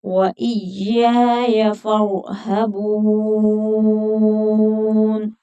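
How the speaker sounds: slow, drawn-out melodic tajweed chant; Quran recitation. Shown as a demonstration. Teacher recites the verse for students, slow melodic chant. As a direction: chant each syllable long and measured.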